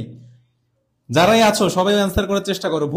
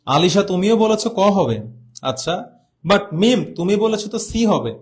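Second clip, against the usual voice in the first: ordinary talk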